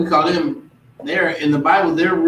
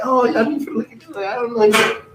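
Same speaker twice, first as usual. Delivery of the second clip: higher, mock dismissive voice; mock snub; 7 semitones higher than usual. Mocking shopkeepers who ignore you later, pitched up. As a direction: brush them off with a snooty act.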